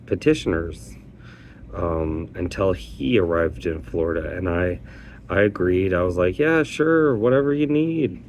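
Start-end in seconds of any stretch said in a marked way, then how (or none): 6.4-8.2 high-pitched, easygoing yes; agreeable. Retold or imitated. Petitioner agreeing to his coordinator's job offer, high and agreeable. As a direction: imitate readily agreeing, pitched well up.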